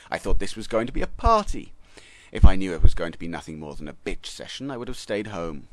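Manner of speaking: loud, booming scold; fed-up guest roaring at the complaining languages. A narrator's reading. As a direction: roar to silence the bickering crowd. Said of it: "roared a voice".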